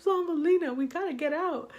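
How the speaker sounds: high, loud mock desperation; retold urgent plea. Storyteller urges friend out of the ditch, acted urgency. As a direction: call it out high, acting urgent.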